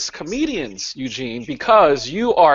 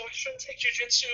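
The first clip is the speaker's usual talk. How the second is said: much higher and softer, a silly voice; high put-on voice; 11 dB quieter than usual. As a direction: say it again in a high goofy voice. Repeating his own joke.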